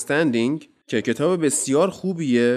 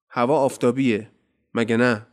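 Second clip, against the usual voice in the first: ordinary delivery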